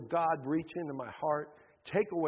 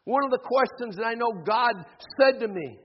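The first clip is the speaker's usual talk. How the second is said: fervent preacher, higher and louder; preaching; 4 semitones higher, 3 dB louder than usual. Acted. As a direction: drive the message home to the congregation. Preacher applies story to congregation.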